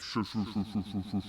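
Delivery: repeated shushes like a fading echo; reverberating shush. Retold or imitated. Tripping man hears the shush echo on, reverberating shush. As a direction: imitate a shush bouncing away. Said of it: "kept hearing the reverberation of".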